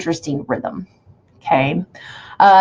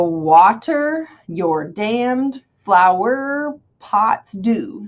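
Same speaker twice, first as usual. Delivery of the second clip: slow sing-song beats on "Water your damned flower pots do"; sing-songy scansion; much slower than usual. Shown as a demonstration. Teacher showing how to hear meter, slow sing-song. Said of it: "you kinda have to do it sing-songy".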